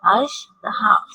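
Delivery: अजदहा drawn out very slowly; slow model word. Shown as a demonstration. Teacher has the class repeat अजदहा slowly. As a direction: stretch every sound of अजदहा. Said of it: "मेरे साथ repeat करें".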